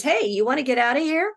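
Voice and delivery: a hint of a tempting monster calling out; demon's offer. Demon speaks to dreamer.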